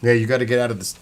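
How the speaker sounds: ordinary talk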